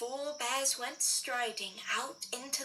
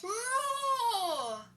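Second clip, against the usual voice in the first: roar; 9 semitones higher than usual. A narrator's reading. Narrator performs monster roar.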